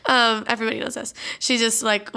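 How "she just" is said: ordinary delivery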